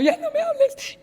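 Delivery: high broken voice with a laugh through tears; retold weepy plea, high and laughing; tearful. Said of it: "Y me metí llorando".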